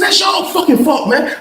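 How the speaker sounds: high-pitched indignant complaint, acted out; exasperated protest. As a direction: protest to the lieutenant in mock outrage.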